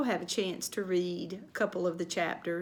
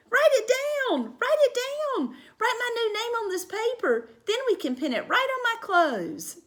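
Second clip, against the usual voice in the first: high, loud, insistent; girl pushing mother to write; eager demand; 13 semitones higher, 3 dB louder than usual. Acted. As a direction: urge mom on. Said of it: "gave the paper to Mother".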